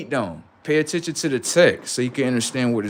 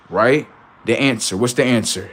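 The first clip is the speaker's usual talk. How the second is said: usual speaking voice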